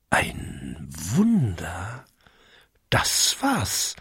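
"Ein Wunder?" slow, low and hushed, rising as the idea dawns; sudden realization. A narrator's reading. Narrator letting Jakob's idea "Ein Wunder?" dawn, slow and hushed. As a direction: draw out "Wunder" softly, wondering. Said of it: "Es wäre ein Wunder".

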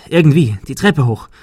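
plain reading voice

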